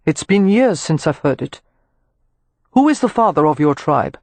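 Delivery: a hint of a smooth male voice; lightly voiced Rowan. Rowan greeting Amelia, lightly voiced courteous man.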